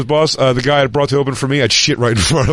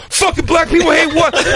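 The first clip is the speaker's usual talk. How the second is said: indignant retort. Retold or imitated. Comic retorting to heckler.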